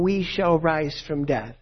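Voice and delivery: normal talking voice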